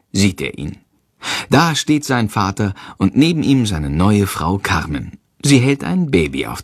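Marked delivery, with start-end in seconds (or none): none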